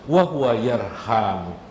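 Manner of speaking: ordinary talk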